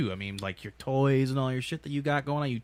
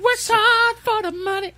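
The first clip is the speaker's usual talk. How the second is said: high squeaky voice; 6 dB louder than usual. Acted. Mock high-pitched joke voice.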